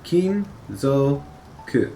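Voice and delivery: kinzoku drawn out slowly, syllable by syllable. Shown as a demonstration. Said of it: "So, kinzoku".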